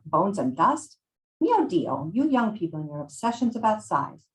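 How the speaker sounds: put-on scolding old Italian woman; hammed-up Italian-accented scolding; Maria, Italian accent. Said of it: "I'm going to butcher an Italian accent".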